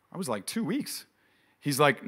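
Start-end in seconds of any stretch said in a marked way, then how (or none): 0.4-1.0 incredulous. Retold or imitated. Shocked at doctor's plan.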